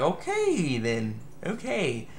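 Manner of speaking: soft, trailing okay then; deflated. Retold or imitated. Reviewer left hanging by the ending, soft. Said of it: "And I'm like".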